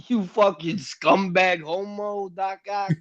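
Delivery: loud, slow, high New York yell; Queens accent. Pretend. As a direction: bellow it like a Queens street kid. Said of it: "a Queens accent".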